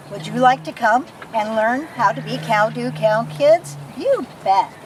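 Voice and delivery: ordinary delivery, nothing marked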